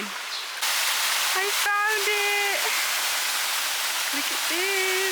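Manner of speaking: thrilled high squeal of discovery; excited. Real, not acted. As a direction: burst out with delight. Finds the hidden pool.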